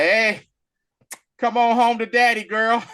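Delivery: loud, high, teasing summons; hammed-up villain call. Mister Sinister beckons his clone, mock-cocky.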